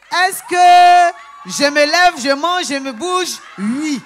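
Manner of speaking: slow, high, loud exclamations; triumphant joy. Real, not acted. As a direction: rejoice over each answer. Elle revit sa joie. (joie triomphante, forte et aiguë)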